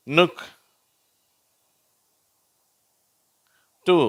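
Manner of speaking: isolated words for repeating, short u, long pauses between; short u drill. Shown as a demonstration. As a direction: model each word from "புட்" to "புஷ்" alone, with a short u. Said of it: "நான் வாசிக்கிறேன், நீங்களும் திருப்பி சொல்லுங்க".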